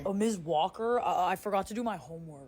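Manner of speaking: soft, low sheepish student; student confessing homework sheepishly; mumbling student. Acted. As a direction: mumble the excuse to the teacher. Student voice to teacher.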